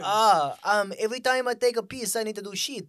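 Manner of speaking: mock Russian accent. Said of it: "Why'd I go Russian?".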